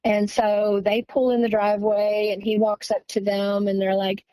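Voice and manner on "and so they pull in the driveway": ordinary talk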